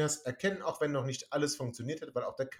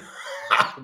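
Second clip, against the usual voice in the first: breathless laugh; 5 dB louder than usual. Real, not acted. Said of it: "alle noch völlig am Lachen".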